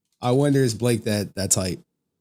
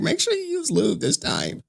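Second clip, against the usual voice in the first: higher, silly mock husband voice; playful mock quote in a higher voice; goofy voice; 8 semitones higher than usual. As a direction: ham up a goofy guy. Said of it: "he say something goofy like".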